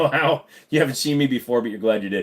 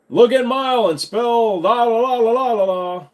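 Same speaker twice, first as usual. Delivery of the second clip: mock singsong; 10 semitones higher, 5 dB louder than usual. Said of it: "I'm not spelling anything".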